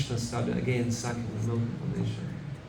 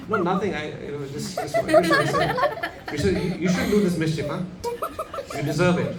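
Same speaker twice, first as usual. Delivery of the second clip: sheepish cover-up; 6 semitones higher, 4 dB louder than usual. Retold or imitated. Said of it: "What did he say?".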